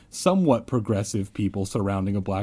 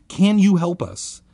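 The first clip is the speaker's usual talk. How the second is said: high, louder plea; begging; 8 semitones higher, 5 dB louder than usual. A narrator's reading. Narrator to chanting kids, begging. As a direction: plead urgently for help. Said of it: "I begged".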